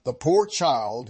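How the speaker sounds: usual speaking voice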